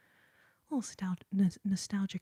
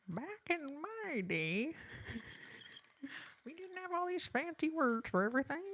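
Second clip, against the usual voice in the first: hammed-up old lady; 8 semitones higher, 5 dB quieter than usual. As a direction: overdo the granny, pitch up and drag it out. Repeats the old-timer line, hammed.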